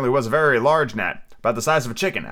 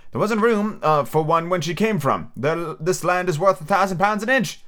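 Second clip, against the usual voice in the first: chorus of passengers; 6 semitones higher than usual. A narrator's reading. Chorus of voices again.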